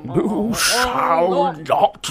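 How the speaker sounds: slow, far-reaching stage voice, each word drawn out; mock Gandalf, stretching out every word; Ian McKellen imitation. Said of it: "Sir Ian McKellen".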